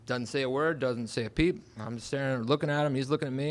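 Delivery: usual speaking voice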